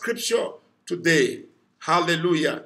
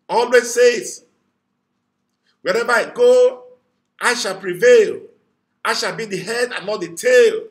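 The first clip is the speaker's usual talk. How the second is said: loud preaching proclamation; fervent loud declaration; 6 dB louder than usual. Real, not acted. Preacher declaring victory aloud for the congregation. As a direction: shout the promise over the day. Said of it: "lift your voice and say".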